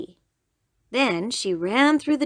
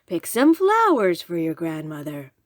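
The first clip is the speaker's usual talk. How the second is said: friendly wolf voice; 3 semitones lower than usual. Acted. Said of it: "becoming friendly".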